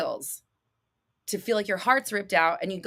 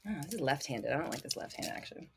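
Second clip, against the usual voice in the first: mutter; 7 semitones lower, 15 dB quieter, faster than usual. Real, not acted. Muttering while fiddling with fan.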